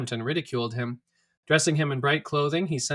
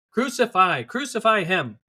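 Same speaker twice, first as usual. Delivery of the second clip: loud, higher insistent chant; shouting louder; 6 semitones higher, 5 dB louder, slower than usual. A narrator's reading. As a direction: drive it home, each cry harder. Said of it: "they kept shouting even louder".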